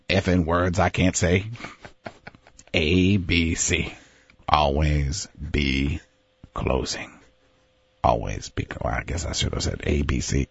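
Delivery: low, slow, menacing sales boss; Baldwin closer speech. Pretend. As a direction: drop low and growl "always be closing". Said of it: "Alec Baldwin Coffee is for Closers".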